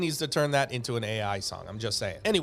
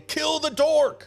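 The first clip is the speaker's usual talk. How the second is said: high, slow rallying yell; mock battle cry; 10 semitones higher, 4 dB louder, slower than usual. A narrator's reading. Creatures join forces against Dork, high drawn-out rallying cry. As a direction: draw the command out like a war cry. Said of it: "all join forces and say".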